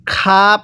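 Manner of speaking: খা as an ordinary aspirated "kha" with open a; no throat scrape on খা; plain-k খা. Shown as a demonstration. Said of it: "পড়াটা সহি শুদ্ধ হবে না". (সাধারণ বাংলা খা, গলার ঘষা ছাড়া)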